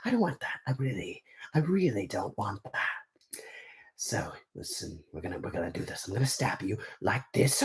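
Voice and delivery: hushed villain, slowing into a whisper; quiet, deliberate killer voice; Hook. Shown as a demonstration. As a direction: threaten softly, drawing out the words. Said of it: "let me try to give you the voice".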